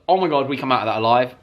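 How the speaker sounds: ordinary talk, nothing marked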